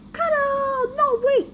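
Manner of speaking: squeaky high cuddly robot voice; Snug's high voice. Acted. Snug grabbing someone, high and eager. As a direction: pitch it way up, eager to hug. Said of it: "And Snug be like".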